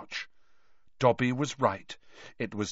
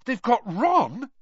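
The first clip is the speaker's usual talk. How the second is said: slow, high-pitched alarm; high, disbelieving dismay; gasped shock; 10 semitones higher, 4 dB louder, slower than usual. A narrator's reading. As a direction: ask it aghast, drawn out. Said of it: "Harry gasped".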